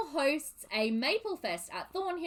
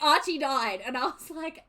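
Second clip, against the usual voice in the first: ordinary talk, nothing marked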